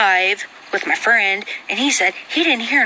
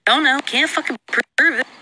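ordinary talk

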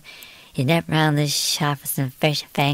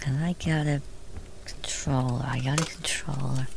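whispered aside; 6 dB quieter than usual. Real, not acted. Muttering while setting up the controller.

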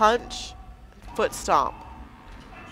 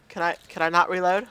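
usual speaking voice

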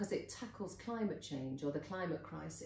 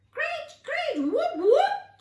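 very high, loud frog calls; chirping frogs; 15 dB louder, slower than usual. A narrator's reading. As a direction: squawk out piercing frog chirps. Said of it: "chirped the frogs".

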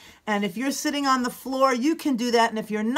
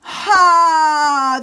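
high, loud, sustained exhale; loud breath-out "haa"; 6 semitones higher, 10 dB louder than usual. Shown as a demonstration. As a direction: let a long sound out, falling slowly. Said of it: "Do it again".

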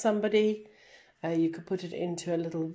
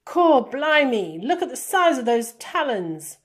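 wildlife presenter exclamation; 6 semitones higher, 9 dB louder than usual. Shown as a demonstration. Teacher reads her model commentary, amazed presenter.

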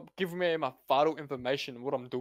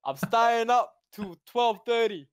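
defiant shout; 5 semitones higher, 7 dB louder, much slower than usual. Real, not acted. Yelling defiantly at mum.

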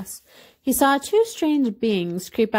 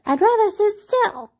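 high-pitched toy-rabbit voice; high storybook refusal; reluctant little rabbit; 12 semitones higher than usual. A narrator's reading. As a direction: decline in a small high voice. Little rabbit declines to dance.

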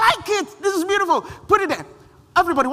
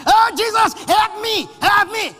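mock crying plea; 7 semitones higher than usual. Imitating people crying to Jesus.

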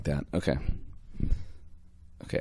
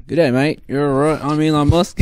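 higher-pitched put-on Australian accent; bad Australian Elon Musk; 5 semitones higher, 4 dB louder than usual. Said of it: "let's hear him talk".

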